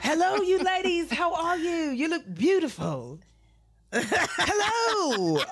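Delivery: high, sing-song greeting; imitating Andre. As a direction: greet the ladies up high and grand. Voicing Andre greeting them.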